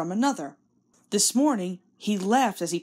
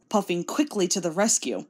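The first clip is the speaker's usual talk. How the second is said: usual speaking voice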